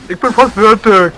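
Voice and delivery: mock shout, high and drawn out; gespielter Schrei. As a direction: yell it like the loudest show-off. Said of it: "die gleich als erstes schreien". (nachgemacht lautes, hohes Schreien)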